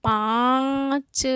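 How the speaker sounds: पाँच with very long nasal आँ, separate च; nasalised vowel demo. Shown as a demonstration. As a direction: stretch the vowel of पाँच through mouth and nose. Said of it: "मुंह भी पूरा खुल रहा है".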